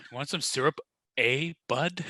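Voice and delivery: put-on Canadian accent with eh; playing a Canadian offering syrup. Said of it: "Canadian accent".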